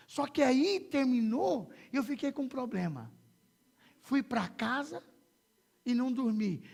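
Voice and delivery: loud, high, driving testimony; full-force preaching. Real, not acted. Preacher tells the congregation about uncontrollable laughter, impassioned preaching.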